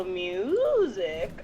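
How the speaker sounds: MUSIC with a long mooing first syllable; music said as a drawn-out "moooo-sic"; cow pun. Acted. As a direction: stretch the "mu" of music like a moo. Cow character makes a moo pun.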